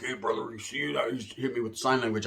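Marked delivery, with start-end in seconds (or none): 0.0-1.1 soft, low undertone; hushed confiding. Retold or imitated. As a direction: pass it quietly to an ally.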